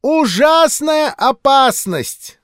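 high, loud, slow wail; drawn-out shrieking warning; wailing old woman. A narrator's reading. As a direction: howl out the danger like a prophet. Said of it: "завопила старуха".

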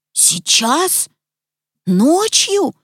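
two separate questions, climbing in disbelief; amazed. A narrator's reading. Petya hears about school at night, amazed. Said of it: "изумился Петя".

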